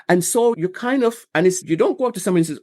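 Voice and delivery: ordinary delivery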